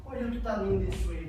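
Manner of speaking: ordinary talk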